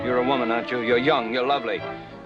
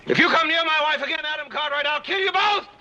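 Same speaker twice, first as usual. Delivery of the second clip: shouted death threat, much higher; angry threat. Acted. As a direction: roar the threat at him. Husband threatens rival.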